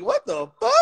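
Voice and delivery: very high-pitched mock shock; high incredulous reaction; retold disbelief. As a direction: squeak it out in exaggerated disbelief.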